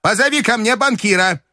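high, resolute command; determined old lady; decisive grandmother's cry. A narrator's reading. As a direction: order it firmly in a high voice. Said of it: "крикнула она решительно".

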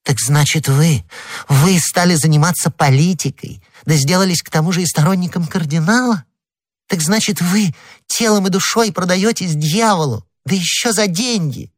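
high-pitched, mounting accusation; outraged wife piling up charges; indignant scolding. Acted. Wife berates husband for his politics.

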